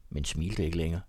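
plain reading voice

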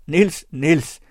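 high, loud appeal by name; råb. A narrator's reading. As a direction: call his name out urgently. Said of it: "råbte hin igen". (højt, indtrængende råb)

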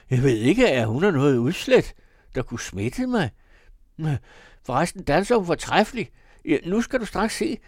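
loud, high, boisterous protest; tipsy defiance. A narrator's reading. Drunk Arthur defending his dancing partner, loudly defiant. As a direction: brush off the objection at high pitch.

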